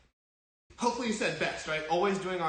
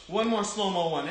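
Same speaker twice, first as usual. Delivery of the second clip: ordinary delivery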